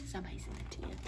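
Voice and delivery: hushed, breathy whisper. A narrator's reading. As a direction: whisper the suspense. Spooky moment in story.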